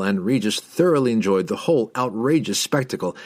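ordinary delivery, nothing marked

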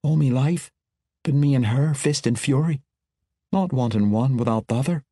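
lightly voiced dwarf girl, grieving; a hint of a rough dwarf lass missing her sister. A narrator's reading. Mala speaks in dwarf voice.

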